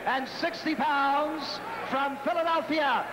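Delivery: ordinary talk, nothing marked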